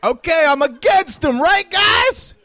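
loud high crowd call; shouting. Acted. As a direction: yell it out to the crowd. Crowd-work shouting to audience.

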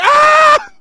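high, loud shrieking squeal; fan scream. Retold or imitated. Comic at the concert throwing panties, a high loud squeal. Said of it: "I was like".

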